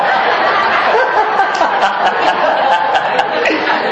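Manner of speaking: sudden breathy laugh, high up; unvoiced giggle; high airy laugh. Real, not acted. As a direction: burst out laughing at the slip. Laughs at naming the wrong temple.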